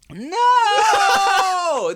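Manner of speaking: loud, high exasperated shout of no. Real, not acted. Shouting at his friend's wrong guess, exasperated. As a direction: yell it, fed up with the wrong guesses.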